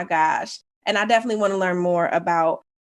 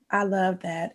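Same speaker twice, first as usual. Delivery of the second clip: soft sigh; 7 dB quieter than usual. Real, not acted. Sighs, moved by answer.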